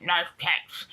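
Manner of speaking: put-on muffled odd accent, vowels twisted toward Australian; strange Australian-like accent. Mimicking the older guy's remark, strange Australian-like accent. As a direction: imitate a strange voice, almost Australian. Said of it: "such a strange voice and accent".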